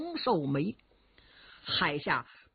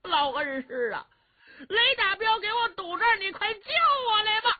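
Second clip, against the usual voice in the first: high shouted plea; 10 semitones higher than usual. A narrator's reading. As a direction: shout an invocation high, begging the old master for rescue. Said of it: "冲着山上高声喊嚷". (冲着山上高声喊嚷求救)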